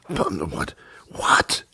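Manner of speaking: incredulous whispered what. Real, not acted. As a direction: breathe it out in disbelief. Whispered disbelief.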